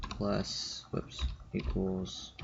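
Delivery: quiet mutter while typing; muttering to self. Real, not acted. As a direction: mumble it under your breath. Muttering a typo fix while typing.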